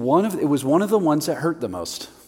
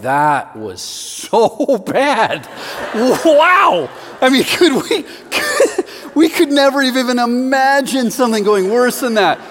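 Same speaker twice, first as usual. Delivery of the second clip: higher, louder, laughing groan of disbelief; hammed-up friends reeling at a disaster; a stunned, laughing "wow"; mock disbelief; 11 semitones higher, 8 dB louder than usual. Friends aghast after the flop.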